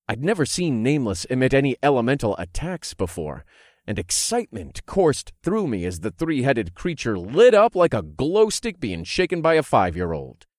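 plain reading voice

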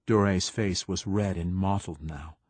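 usual speaking voice

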